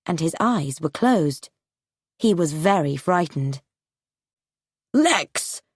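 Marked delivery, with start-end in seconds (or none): 4.9-5.6 shout. Acted. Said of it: "shouted his father".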